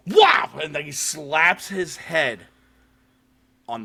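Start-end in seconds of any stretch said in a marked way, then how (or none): 0.1-0.5 very loud, high "whap"; imitated impact noise. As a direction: voice a sudden, explosive smack. Imitates head slamming on table.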